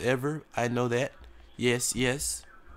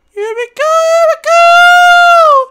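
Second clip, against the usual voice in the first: high, louder and louder squeal of takeoff; excited shriek; 21 dB louder than usual. Real, not acted. Player taking off in a plane, squealing high with excitement. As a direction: let the words fly up into a squeal.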